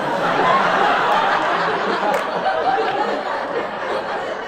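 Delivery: big loud breathy burst of laughter. Real, not acted. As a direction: let out a loud helpless laugh. Bursts out laughing after shout.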